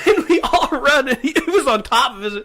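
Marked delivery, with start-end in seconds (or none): none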